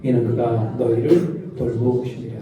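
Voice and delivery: leading a slow group Bible reading; slow unison scripture reading. Shown as a demonstration. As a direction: read it slowly together with the congregation. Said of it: "시작".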